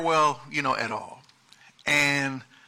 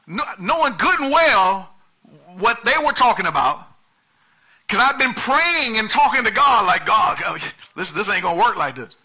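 rising, louder preacher's cry to God; preaching at full force; 4 semitones higher, 5 dB louder than usual. Acted. Preacher telling the congregation, at full force. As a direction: pour it out like a pleading preacher.

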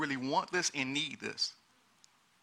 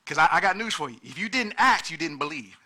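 preaching at full force; 5 dB louder than usual. Acted. Pastor pressing the congregation on currency, full-force preaching.

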